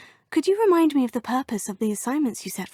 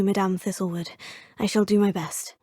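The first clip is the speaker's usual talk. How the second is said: usual speaking voice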